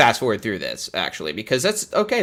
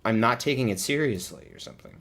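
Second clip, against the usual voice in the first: ordinary delivery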